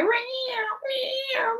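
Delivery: high, thin, pitiful meows; imitating kitten mews. Retelling the rescue, she mimics high kitten meows. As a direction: mew like a trapped kitten. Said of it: "and I hear".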